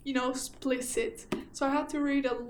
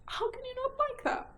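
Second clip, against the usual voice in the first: delighted squeal; 12 semitones higher than usual. Real, not acted. Gushing over a spooky book title.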